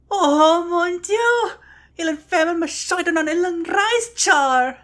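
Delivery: gasp, then high, loud lament; hammed-up wail. Reciting the sample text in Yodean, hammed-up wailing.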